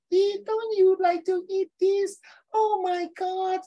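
very high, drawn-out mock wail; exaggerated high-pitched pleading, stretched out; vegan teacher. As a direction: impersonate her in a slow, squeaky falsetto. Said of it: "She talk like that, right?".

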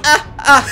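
high breathy villain cackle; evil laugh. Pretend. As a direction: gloat like a cartoon villain. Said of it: "Mira mi risa malvada".

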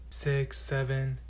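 hushed, slow counting; quiet slow counting. Acted. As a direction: count softly and slowly. Counting on fingers, caught.

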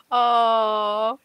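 ordinary talk

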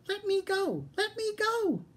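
very high squealing little pig; piglet squeal. A narrator's reading. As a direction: squeal it high, struggling to get free. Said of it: "The piglet kicked and squealed".

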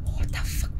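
breathless shocked whisper; whispered what the fuck under the breath; whispered swear. Real, not acted. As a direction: hush it out in disbelief. Alarmed whisper at spooky sight.

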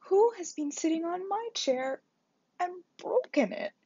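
high little bear voice, near tears; a tiny bear upset about his chair; Baby Bear. A narrator's reading. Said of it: "and he began to cry". (Bébé Ours, voix aiguë et chagrinée)

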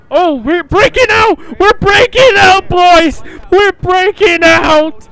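loud, whooping announcement; excited shouting. Real, not acted. Player calling to the boys during the breakout, excited shouting.

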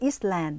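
island misread as Eastland, opening ees; mispronounced vowel and S. Shown as a demonstration. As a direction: say island wrongly as ees-land with an audible S. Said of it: "หรือว่า Eastland นะคะ ไม่ใช่". (อ่าน island ผิดเป็นอีสแลนด์ ออกเสียงตัว S)

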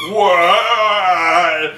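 high, loud, drawn-out "what"; mock outraged yell. Infected man shut out of the ship, mock outraged yell. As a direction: wail it in hammed-up disbelief.

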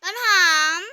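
loud call of the name. Acted. Big sister shouts for little sister, loud call. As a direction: shout for her from afar.

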